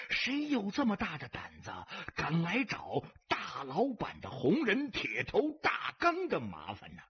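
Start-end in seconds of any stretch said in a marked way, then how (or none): none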